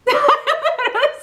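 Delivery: high, awkward giggle; embarrassed laugh. Real, not acted. Awkward moment on camera.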